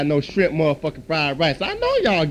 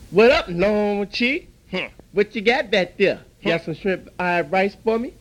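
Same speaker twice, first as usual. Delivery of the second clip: ordinary talk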